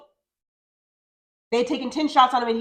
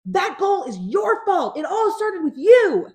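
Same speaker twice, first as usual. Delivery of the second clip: slow, high, loud accusing yell; blaming shout; 8 semitones higher, 5 dB louder, slower than usual. Retold or imitated. Parent blaming the seven-year-old goalie, slow loud yell. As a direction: pin the blame on him, hard. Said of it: "Danny's like".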